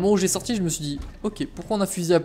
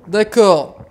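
annoyed; 2 semitones higher, 9 dB louder than usual. Real, not acted. Frustrated after dying in game.

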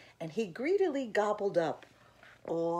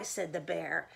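ordinary delivery, nothing marked

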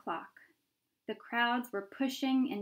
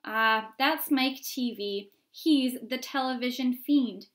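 crowd onlooker; 3 semitones higher, 6 dB louder than usual. Acted.

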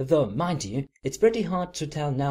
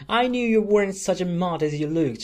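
louder, higher, gleeful exclaim; a gleeful cry; cried out; 5 semitones higher, 4 dB louder than usual. A narrator's reading. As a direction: crow it out, delighted with herself. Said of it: "she cried".

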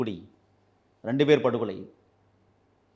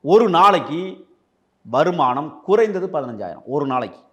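loud accusation; 5 semitones higher, 7 dB louder than usual. Real, not acted. Loud accusation about police bribes.

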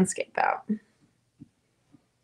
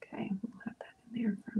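whispering; 15 dB quieter than usual. Real, not acted. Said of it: "whispering while painting".

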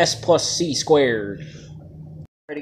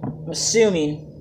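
normal talking voice

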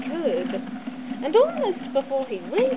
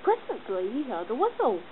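ordinary delivery

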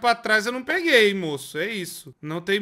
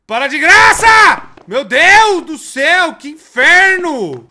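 loud, high exasperated shout; furious outburst at the screen; frustrated yell; 10 semitones higher, 16 dB louder than usual. Real, not acted. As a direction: scream at the game to stop messing around. Gamer furious at stuck puzzle.

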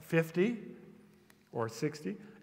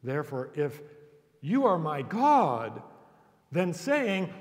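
ordinary delivery

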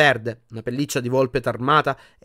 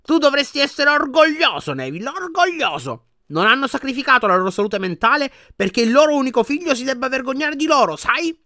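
angry grandmother; 12 semitones higher, 5 dB louder than usual. A narrator's reading. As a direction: flare up at the grandson. Grandmother scolding in anger. (la nonna si adira, voce molto acuta)

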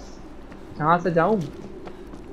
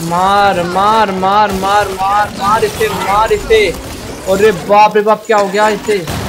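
loud, rapid-fire repeated battle cries; urgent shouting; 3 semitones higher, 5 dB louder, slower than usual. Real, not acted. Gamer urges the attack on an enemy, urgent shouting. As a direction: shout it over and over in the heat of a fight.

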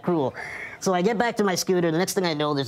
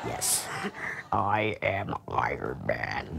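much lower, slow, softer grand hero line; movie hero voice; 6 dB quieter, much slower than usual. Pretend. Answering the crowd as a hero, much lower, slow and grand. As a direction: deliver it like the film's epic closing line. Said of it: "Something Aussie in my head".

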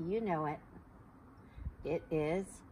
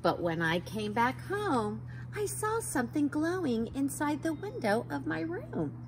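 bright, higher storybook reading; reading to children; 5 semitones higher than usual. A narrator's reading. Teacher reads a rainbow book to kids, lively. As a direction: read it like a picture book to little ones.

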